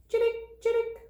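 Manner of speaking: quick high chittick chirps; sparrow chirp. A narrator's reading. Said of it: "Sparrow makes a simple jingle".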